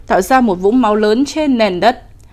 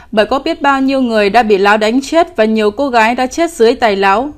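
usual speaking voice

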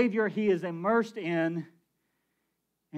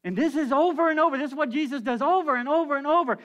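building fervour; 5 semitones higher, 4 dB louder, faster than usual. Real, not acted. Preacher builds emphasis.

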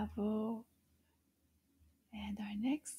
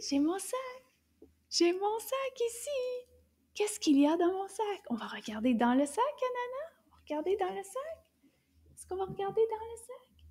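high, fast chirpy puppet; playful high puppet chatter; 8 semitones higher, faster than usual. Pretend. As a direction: play the little puppet eagerly. Puppet Anana voice.

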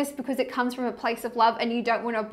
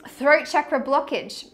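usual speaking voice

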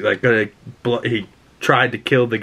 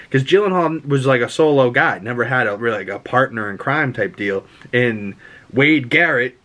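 ordinary talk

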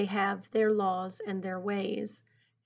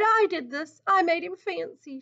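higher acted confession, no real tears; sobbed; 6 semitones higher than usual. A narrator's reading. Naomi confesses to the elders, mild acted sob. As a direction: cry it out, pitched up. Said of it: "she sobbed".

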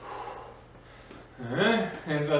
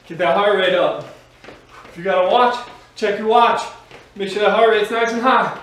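loud upbeat workout coaching; aerobics instructor; 2 semitones higher, 5 dB louder than usual. Acted. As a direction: pump up the class, energetic. Fitness instructor pumping up class.

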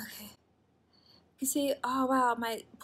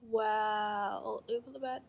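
ordinary talk

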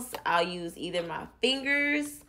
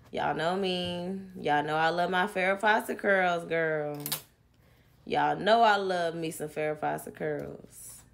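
ordinary talk

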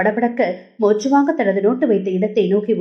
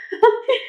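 giggle; 13 semitones higher than usual. A narrator's reading. Girl laughs running out.

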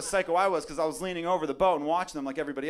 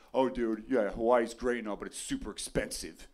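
mock naysayer; 8 semitones lower, 6 dB quieter than usual. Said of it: "a lot of people were like".

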